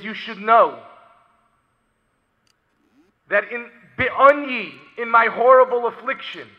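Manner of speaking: preacher crying out a survivor's testimony; impassioned preaching. Acted. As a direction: declare it with force. Preaching with force.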